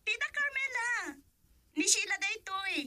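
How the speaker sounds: high, loud, bright-eyed hello to an aunt; excited greeting. Acted. Niece calls her aunt long distance, excitedly. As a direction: call out happily to your tita.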